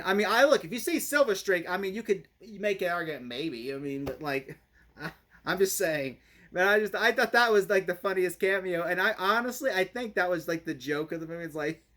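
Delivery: ordinary delivery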